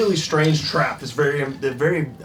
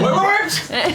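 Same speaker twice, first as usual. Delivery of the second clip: panicked yell. Retold or imitated. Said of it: "As he's like".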